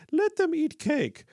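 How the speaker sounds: high-pitched, slow mock-royal voice; mock out-of-touch aristocrat. Mocks an out-of-touch politician with quote.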